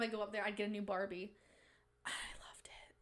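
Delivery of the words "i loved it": breathy whisper of delight; whispered gushing. Real, not acted. Whispering to viewers about loving Barbies. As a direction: whisper it like a secret treasure.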